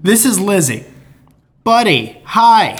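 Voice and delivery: lightly voiced friend greeting; a hint of a bubbly friend texting hello; Lizzie's voice. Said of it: "put a different inflection on my voice".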